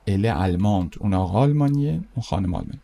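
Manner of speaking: ordinary delivery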